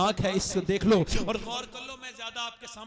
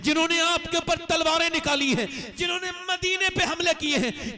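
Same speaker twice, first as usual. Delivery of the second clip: shouted, much higher and louder climax; preaching at full force; 11 semitones higher, 7 dB louder than usual. Acted. Preacher shouting the Prophet's words of forgiveness at the climax. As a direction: thunder the Prophet's mercy to the crowd.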